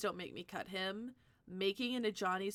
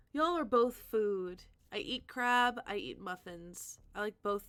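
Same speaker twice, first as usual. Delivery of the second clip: ordinary talk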